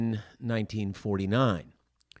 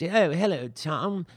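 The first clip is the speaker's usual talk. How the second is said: high, louder Mid-Atlantic film-star greeting; Mid-Atlantic dialect; 8 semitones higher, 4 dB louder than usual. Retold or imitated. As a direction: ham up an old Mid-Atlantic movie hello. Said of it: "They called it the Mid-Atlantic dialect".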